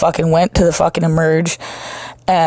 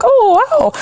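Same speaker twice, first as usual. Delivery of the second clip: much higher mock-impressed wow; nurse amazed; 3 dB louder than usual. Nurse impressed by her finding the vein, high surprise. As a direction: play the nurse gasping in surprise. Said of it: "she'd be like".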